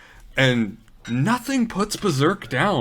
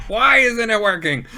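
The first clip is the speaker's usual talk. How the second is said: high, loud panicked cry; panicked offscreen voice; 10 semitones higher, 5 dB louder than usual. Retold or imitated. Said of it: "while we hear".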